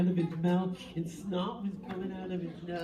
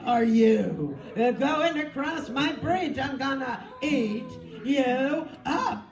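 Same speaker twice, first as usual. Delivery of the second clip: slow, loud, higher hammed-up troll; 5 semitones higher, 6 dB louder, much slower than usual. Troll threatens the goat on his bridge, slow and loud. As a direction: growl out the threat like a greedy troll. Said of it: "He said / He roared".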